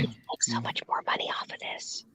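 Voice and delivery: soft joking whisper. Pretend. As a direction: keep the whisper going.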